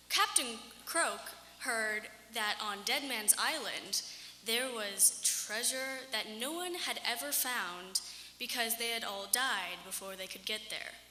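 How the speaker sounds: ordinary delivery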